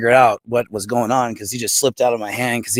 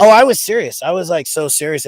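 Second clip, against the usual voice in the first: high, loud, insistent; emphatic protest; 6 semitones higher, 4 dB louder than usual. Real, not acted. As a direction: protest it earnestly.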